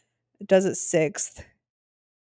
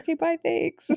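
breezy goodbye; 9 semitones higher, 4 dB quieter than usual. Retold or imitated. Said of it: "They're like".

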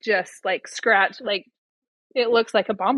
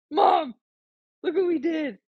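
put-on higher-pitched show-off kid voice; imitated excited kid; 6 semitones higher than usual. Son showing Mom the basketball, lightly voiced excitement. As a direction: lightly play a proud son calling Mom.

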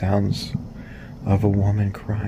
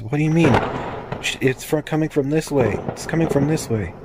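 higher-pitched, urgent hurrying; acted urgency, pitch raised; urgent insistence; 7 semitones higher than usual. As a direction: urge a partner to follow you right now. Partner urgently hurrying cousin on.

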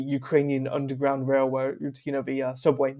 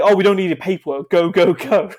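mock urgent, raised and loud; urgent waving through; 5 semitones higher, 9 dB louder than usual. Border official hurrying volunteers past paperwork, mock urgent. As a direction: rush them through, high and loud, acting it out.

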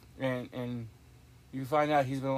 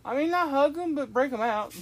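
excited outburst; 13 semitones higher, 7 dB louder than usual. Real, not acted. Joking reply to wife.

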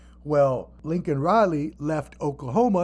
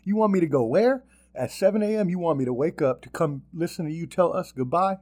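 higher, incredulous questioning; indignant, raised-pitch comeback; acted disbelief; 5 semitones higher than usual. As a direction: balk at an absurd early-morning summons. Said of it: "I've been like".

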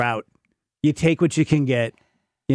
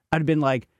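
normal talking voice

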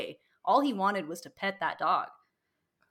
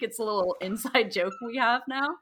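ordinary talk, nothing marked

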